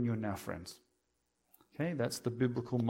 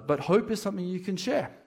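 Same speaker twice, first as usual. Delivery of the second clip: higher and brighter, pushed with conviction; higher, stronger restatement of hope; emphatic refrain; 7 semitones higher, 3 dB louder than usual. Acted. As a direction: lift the line like a rallying call. Sermon refrain, repeated stronger.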